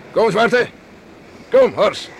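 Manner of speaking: loud, high call to the horses. Acted. Calling the horses after racing. (luid de paarden roepen)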